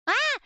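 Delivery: high, loud "ah" of exasperation; short cry of frustration at a stubborn computer; frustrated yelp. Real, not acted. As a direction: yelp as it foils you. Frustrated by screenshot failing.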